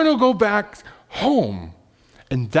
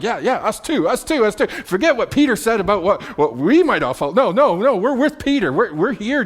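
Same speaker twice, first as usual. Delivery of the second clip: higher, insistent chorus of agreement; eager rallying; 4 semitones higher, 3 dB louder than usual. Acted. Disciples rallying behind Peter to Jesus, eager and insistent. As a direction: pile in eagerly, repeating words on top of each other. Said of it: "taking the cue from Peter's courage".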